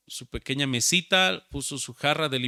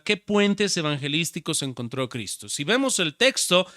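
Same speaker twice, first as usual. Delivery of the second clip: normal talking voice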